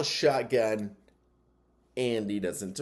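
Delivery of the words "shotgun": usual speaking voice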